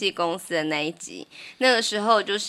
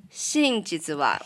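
slow teaching read; much slower than usual. Shown as a demonstration. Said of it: "那我们就先跟萝莉一起哦".

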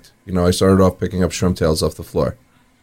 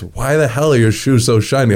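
higher, baffled question; incredulous; 5 semitones higher than usual. Retold or imitated. Manager baffled by the teen's shiny shoes, incredulous. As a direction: act amazed at the shiny shoes.

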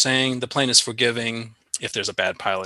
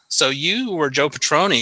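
normal talking voice

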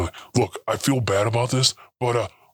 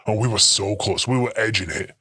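ordinary talk

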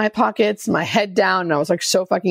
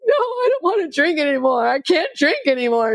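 pleading younger self; 8 semitones higher than usual. Retold or imitated. Retold plea to sponsor.

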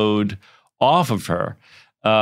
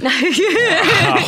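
laughing wow; 5 dB louder than usual. Real, not acted. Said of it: "Wow, it's a lot".

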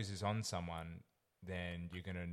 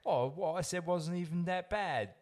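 put-on old london cockney; 9 semitones higher, 4 dB louder than usual. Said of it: "he's like".

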